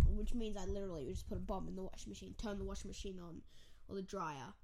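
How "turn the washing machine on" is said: ordinary delivery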